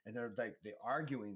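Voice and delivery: ordinary delivery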